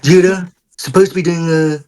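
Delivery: mock American president declaring things; hammed-up presidential impression; Donald Trump. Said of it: "of Donald Trump".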